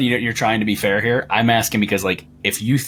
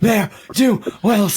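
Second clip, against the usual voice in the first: Hagrid voice; 11 semitones higher, much slower than usual. Acted. GM voices Joseph sneering at the thugs, slow and high. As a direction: stretch the word out like a drunk giant. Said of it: "Joseph is now Hagrid".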